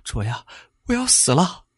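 high, thin frightened voice; acted panicked wail; terrified. A narrator's reading. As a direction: cry out in dread of dying. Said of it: "一脸惊恐道".

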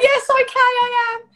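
high, loud squawked confession; mock-defensive yelp; exasperated admission. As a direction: blurt it out as if caught red-handed. Acts out her caught-out reply.

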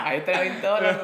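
laughing loudly through the words; cracking up while telling it. Real, not acted. Telling the bet story, laughing loudly.

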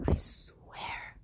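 a whispered, crying vow; tearful whisper. Acted. Koiki quietly swears through tears while fearing for Nancy.

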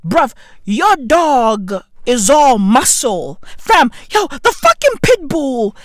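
normal talking voice

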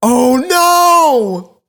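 real high, loud wail of dismay; horrified outcry. Real, not acted. Listener realizing the IV was ripped out, horrified outcry. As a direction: cry out in appalled realization. Friend reacts to the gory reveal.

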